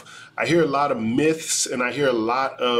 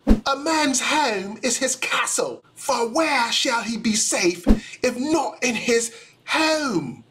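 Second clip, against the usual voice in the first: hammed-up British accent, much higher and slow; terrible British accent; 8 semitones higher, slower than usual. Lawyer acting out Sir Edwin Coke in a terrible British accent. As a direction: overdo a bad British accent, slow and lofty. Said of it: "with that terrible of a British accent".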